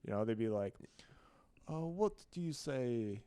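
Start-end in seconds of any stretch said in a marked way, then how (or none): 1.7-3.2 slow, soft attempt at British English; badly faked British-European accent. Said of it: "trying to do a British accent".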